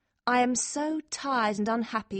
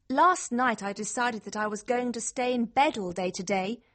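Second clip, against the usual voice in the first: ordinary delivery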